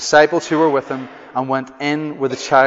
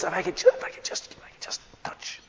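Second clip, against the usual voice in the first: desperate whisper; 12 dB quieter, faster than usual. Retold or imitated. Woman creeping up to touch Jesus.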